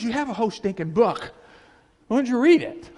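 high, loud exasperated rebuke; exasperated scolding. Retold or imitated. Jesus scolding believers who won't read, loud and exasperated. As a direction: throw it back at them, fed up.

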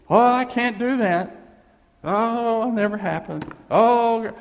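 loud mock whining of a doubter; whiny doubter. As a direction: whine like a defeatist. Voicing a doubting believer.

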